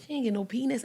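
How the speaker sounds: ordinary talk, nothing marked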